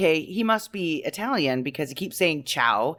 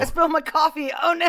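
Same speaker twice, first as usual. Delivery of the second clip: mock bad acting; 12 semitones higher, faster than usual. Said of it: "This is so bad".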